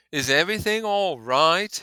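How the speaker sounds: high, loud nervous Gatsby. Acted. Nervous Gatsby asking his host if all is ready, high and loud. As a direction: pitch up high and anxious for the young man.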